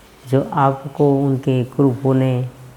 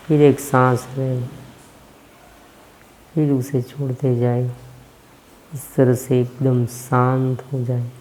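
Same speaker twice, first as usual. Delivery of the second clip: guided meditation. Acted. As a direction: ease the listeners into stillness, unhurried. Leads a breathing meditation.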